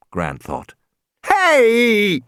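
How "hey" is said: sudden high yell while falling; a sharp cry as he goes down; startled shout. A narrator's reading. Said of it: "Regis shouted as he fell".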